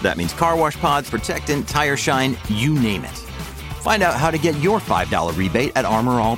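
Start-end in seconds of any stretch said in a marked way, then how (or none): none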